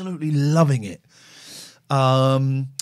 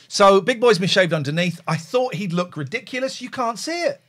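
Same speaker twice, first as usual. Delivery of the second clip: ordinary delivery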